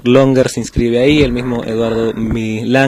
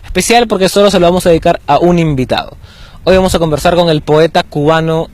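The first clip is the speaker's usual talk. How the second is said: upbeat, fast radio host opening; show host intro; 5 semitones higher than usual. Acted. Host greeting his audience, bright and quick. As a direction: welcome listeners like a lively host.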